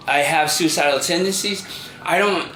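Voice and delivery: usual speaking voice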